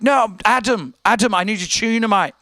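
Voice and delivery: pleading mock British customer. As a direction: protest in a put-on British voice. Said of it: "he had a British accent".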